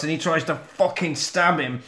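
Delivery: ordinary talk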